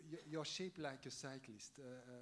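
usual speaking voice

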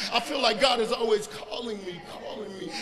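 ordinary delivery

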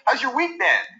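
high rapport-seeking small talk; supplicating, sales-call lilt up high; very high pitch. Shown as a demonstration. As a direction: ask in a high, eager-to-please voice. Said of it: "Very high pitch".

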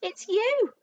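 high, loud, gloating accusation; horribly gleeful. A narrator's reading. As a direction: pounce on it through a horrible grin. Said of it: "he said, grinning horribly".

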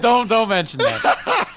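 laughing, high and slow; giggling through the words. Real, not acted. Laughing over embarrassing topic.

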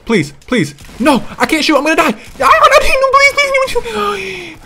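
shouting that climbs into a shriek; panicked pleading. Real, not acted. Player about to die, frantic screamed pleading.

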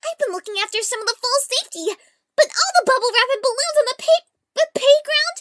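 high, loud, eager character voice; high-voiced character. Character looking after the foals' safety, high and loud. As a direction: pitch it well up and project it. Acting a scripted character line.

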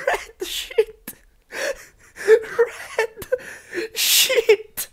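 very high, breathless wheezing laughter; dying of laughter. Real, not acted. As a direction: squeak the words out between helpless wheezes. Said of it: "I'm dying".

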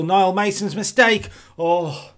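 loud, high, drawn-out cry of dismay; alarmed commentary. Real, not acted. Commentator seeing his defender blunder late on, alarmed. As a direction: shout the mistake in alarm. Calling a defensive error live.

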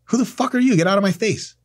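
brusque, high-pitched rudeness; rude stranger. Retold or imitated. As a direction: snap at the stranger like a jerk. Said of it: "It'd be like".

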